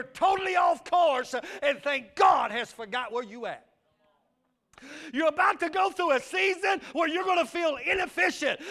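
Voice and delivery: loud, fervent sermon delivery; preaching at full force. Acted. Preacher to his congregation, loud, fervent preaching. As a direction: preach it out to the back pews.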